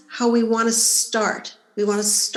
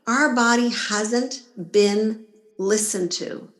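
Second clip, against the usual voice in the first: normal talking voice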